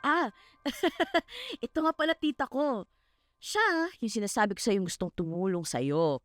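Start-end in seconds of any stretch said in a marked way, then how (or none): 0.6-1.6 light giggle. Acted. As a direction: giggle lightly at a higher pitch. Friend laughs while introducing aunt. (mahinang hagikgik)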